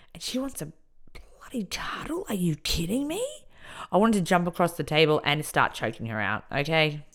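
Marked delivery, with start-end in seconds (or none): 0.1-3.2 high, hushed, squeezed disbelief; incredulous outrage. Retold or imitated. Inmate fuming over the cuddle request, hushed incredulity. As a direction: drop the volume and squeak in outrage.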